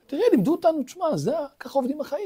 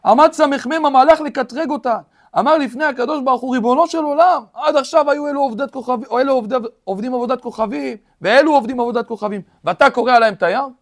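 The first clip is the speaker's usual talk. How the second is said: loud preaching of the accuser's charge; preacher raising his voice; forceful preaching; 4 semitones higher, 8 dB louder than usual. Acted. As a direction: hammer out the accusation to the hall.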